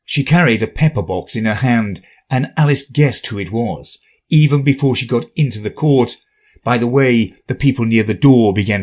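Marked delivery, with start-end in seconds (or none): none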